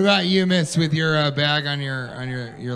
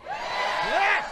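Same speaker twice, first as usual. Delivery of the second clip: crowd cheer; 4 dB quieter than usual. Real, not acted. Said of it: "audience shout".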